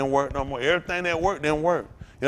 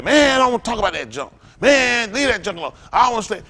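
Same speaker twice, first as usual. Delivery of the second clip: put-on old drunk self; 7 semitones higher, 6 dB louder than usual. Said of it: "Man, I used to be like that".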